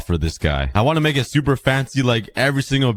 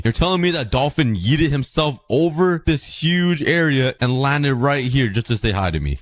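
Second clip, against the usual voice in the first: usual speaking voice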